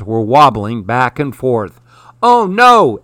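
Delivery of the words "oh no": high, loud cry of dismay; alarmed exclamation. A narrator's reading. Harry sees the pumpkins rolling away, alarmed exclamation. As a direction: exclaim it in alarm, pitch leaping up. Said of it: "exclaimed Harry".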